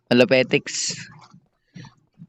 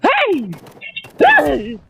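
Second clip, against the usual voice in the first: shouting hey. Real, not acted. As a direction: yell to get attention. Calling out at other player.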